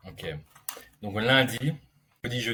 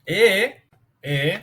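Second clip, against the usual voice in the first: « Et » lancé beaucoup plus haut et fort; s'emballe sur le « Et »; excité; 9 semitones higher, 8 dB louder than usual. Real, not acted. Enchaîne, excité. (« Et » crié, tout excité)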